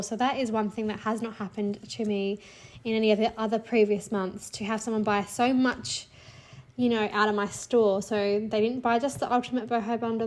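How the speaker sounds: normal talking voice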